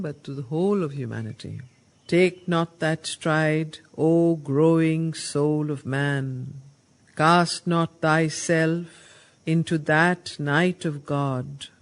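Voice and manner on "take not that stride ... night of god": slow, louder proclaiming of the verse; solemn declamation. Acted. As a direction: call out to all mankind like a prophet. Narad addresses all humanity.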